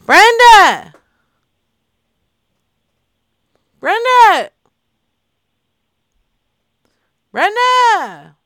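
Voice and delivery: loud, high holler of a name; insistent, stretched-out name call. Pretend. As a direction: holler it high, letting it fall away. Calling for Brenda on the phone.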